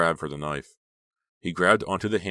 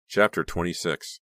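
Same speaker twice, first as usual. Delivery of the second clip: plain reading voice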